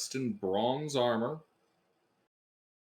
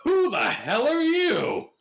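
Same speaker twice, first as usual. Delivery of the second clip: much higher, louder, slow demand; angry skeleton warrior; 13 semitones higher, 9 dB louder, slower than usual. Acted. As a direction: roar it at the intruders. Skeleton warrior confronts party.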